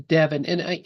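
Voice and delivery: usual speaking voice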